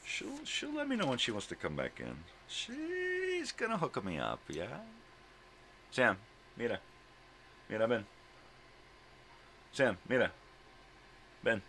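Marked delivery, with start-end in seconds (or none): 2.5-4.4 very high, slow, sing-song dog voice; voicing the dog. Owner speaking for her dog in a high, slow voice. 5.9-11.6 loud, raised call in Spanish; calling the dog. Real, not acted. Owner calling her dog to come inside, raised voice.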